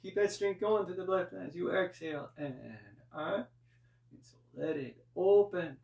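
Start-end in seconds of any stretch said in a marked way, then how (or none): none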